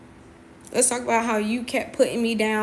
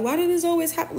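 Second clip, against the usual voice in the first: high, mock tearful complaint; imitated crying; 7 semitones higher, faster than usual. Imitating friend crying over being stood up, mock tearful. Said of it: "And she's crying 'cause".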